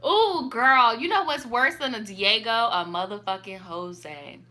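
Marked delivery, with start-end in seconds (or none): 2.5-4.4 dragged-out punchline. Real, not acted. Punchline about names.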